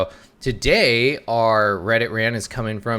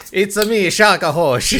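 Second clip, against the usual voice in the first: hammed-up Italian Mario voice, pitched higher; mock Mario accent; 7 semitones higher, 4 dB louder than usual. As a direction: put on a cartoonish Italian plumber accent, laughing. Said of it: "you're like Mario".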